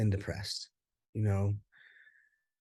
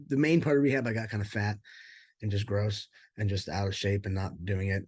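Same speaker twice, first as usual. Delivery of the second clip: ordinary talk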